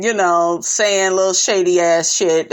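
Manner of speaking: ordinary delivery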